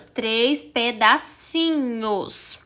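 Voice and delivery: "três pedacinhos" slow and broken into beats; pausado, em pedacinhos. Shown as a demonstration. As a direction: draw out each syllable of "três pedacinhos". Teacher demonstrates pieces of words. ("três pedacinhos" bem devagar, aos pedacinhos)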